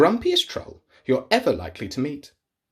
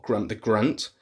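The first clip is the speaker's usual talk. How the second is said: plain reading voice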